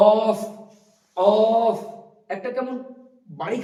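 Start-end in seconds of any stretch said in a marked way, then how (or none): 0.1-1.8 অফ loud with the tongue back on the palate; deep Arabic qaf. Shown as a demonstration. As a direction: knock অফ hard at the back of the mouth. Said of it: "আমার সঙ্গে সঙ্গে বলার উচ্চারণ চেষ্টা করুন". (জিভের শেষ ভাগ তালুতে লাগিয়ে জোরে অফ)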